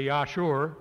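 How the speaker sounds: lightly voiced Minnesota farmer accent, Yeah, sure. Speaker imitating Minnesota farmers for audience, light Minnesota accent. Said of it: "Minnesota farmers mean when they say".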